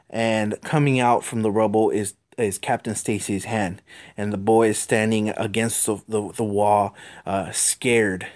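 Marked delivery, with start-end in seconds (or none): none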